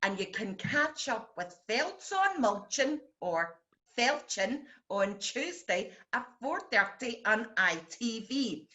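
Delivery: ordinary talk, nothing marked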